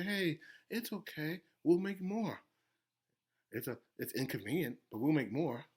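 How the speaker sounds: softly reassuring parent bear; soothing. Acted. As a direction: comfort a crying little one, hushed. Bear parents soothing crying baby.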